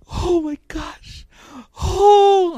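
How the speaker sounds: high-pitched, hammed-up weepy vlogger; mock crying. Preacher imitating lady crying on camera, mock sobbing. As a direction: wail it like a vlogger faking tears. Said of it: "she's crying on camera".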